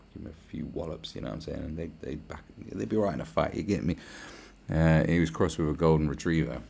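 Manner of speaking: ordinary talk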